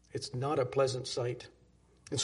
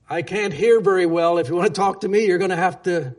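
put-on loud, raised voice of a hard-of-hearing old man; 3 semitones higher, 10 dB louder than usual. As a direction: call it out like someone who can't hear himself.

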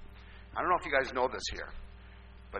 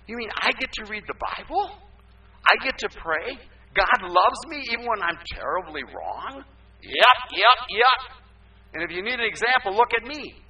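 higher, eager voice of a delighted newcomer; excited convert; 7 semitones higher, 6 dB louder than usual. Retold or imitated. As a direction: bubble over with disbelief and joy. Pastor voices Kim's joy.

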